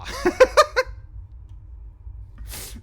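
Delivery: high, unvoiced giggle; wheezing laugh of disbelief; high breathy laugh. Real, not acted. Laughs at long distance.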